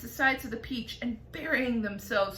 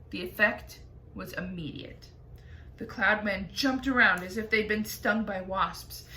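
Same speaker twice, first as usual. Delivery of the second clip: ordinary delivery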